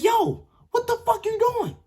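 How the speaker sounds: very high, loud, incredulous yell; retold outraged yell. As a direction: yell in disbelief at the roommate. Confronts roommate peeing on carpet.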